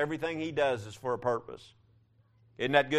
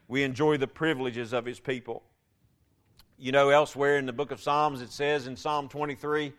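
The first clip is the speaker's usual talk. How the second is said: ordinary talk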